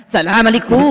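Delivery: put-on loud Arabic greeting in a thick Alsatian accent. Grandmother greeting people in Arabic, heavy Alsatian accent. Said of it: "an Alsatian accent. It's like a German accent".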